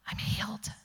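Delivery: breathless whisper of disbelief; hushed amazement, half to herself; awed whisper. Retold or imitated. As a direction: whisper it as if it just dawned on you. Sudden realization in the store.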